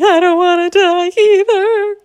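high, slow sung fear; sing-song scared. Acted. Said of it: "inwardly she was thinking".

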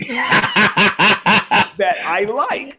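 slow, drawn-out line, laughing through it. Real, not acted. Teasing his rival-fraternity friend, laughing. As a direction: grin and laugh as you admit it.

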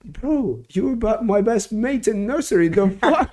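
high-pitched, incredulous outburst; retold astonishment. Retelling finding his best mate from nursery, astonished. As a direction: voice shooting up in amazed recognition. Retelling moment of recognising old friend.

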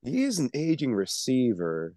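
high, slow doubting drawl; mock the doubter, stretch it out; skeptical pundit. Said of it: "still sitting here like".

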